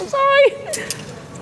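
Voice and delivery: squeaky drawn-out sorry; high, slow mock apology. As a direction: whimper it like a guilty kid. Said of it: "I was like".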